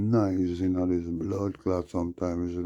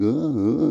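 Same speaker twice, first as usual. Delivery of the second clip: mock moans; 10 semitones higher, 8 dB louder than usual. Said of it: "She's like".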